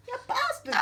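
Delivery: ordinary talk, nothing marked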